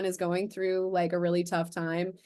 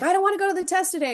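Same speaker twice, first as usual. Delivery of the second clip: put-on much higher, louder and fast, a whining child; whiny kid; 11 semitones higher, 5 dB louder, much faster than usual. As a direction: whine like a kid dodging a test. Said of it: "I'm like".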